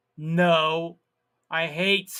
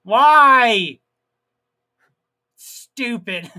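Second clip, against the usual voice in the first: exasperated yell; 4 semitones higher, 12 dB louder, much slower than usual. Retold or imitated. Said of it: "and I'm like".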